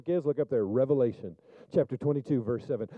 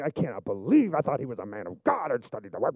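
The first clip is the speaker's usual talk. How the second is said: lightly voiced indignant churchgoer; a hint of offended disbelief; mock disgusted listener. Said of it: "You are really disgusted with me".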